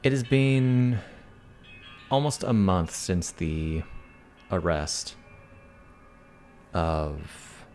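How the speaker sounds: slow, measured narrator voice; scene narration. Acted. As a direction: set the scene calmly.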